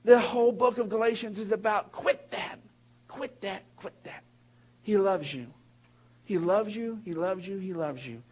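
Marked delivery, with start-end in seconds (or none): 1.9-4.2 quieter and quieter each time; fading repetition. Acted. Preacher to the congregation, fading to a hush. 4.8-8.2 softening with each repeat; tender reassurance. Real, not acted. Pastor reassuring his listeners, tender and fading.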